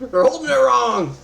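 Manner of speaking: loud high mock dad shout; joking loud complaint; mock yell. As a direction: yell like an exasperated father. GM joins the dad-yell joke.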